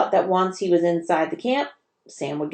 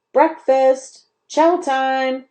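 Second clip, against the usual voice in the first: higher, louder call to breakfast; boys yelling; 7 semitones higher, 4 dB louder, much slower than usual. A narrator's reading. Boys yelling to each other as the bell rings. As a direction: shout it across camp. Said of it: "yelled the boys".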